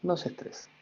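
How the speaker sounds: breathy hushed reminder not to stress; gentle whispered aside; soft whispered advice. Shown as a demonstration. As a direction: whisper it softly, half to yourself. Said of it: "Aunque suene como imposible".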